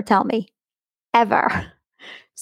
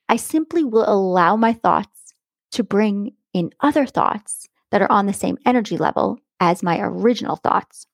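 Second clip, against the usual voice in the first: normal talking voice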